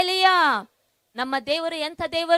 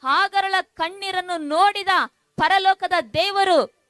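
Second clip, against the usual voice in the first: insistent preacher's promise, slightly louder; fervent preaching; 3 semitones higher, 3 dB louder than usual. Real, not acted. Pastor promising tearful listeners God will act, fervent preaching. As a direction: press the promise home to the crowd.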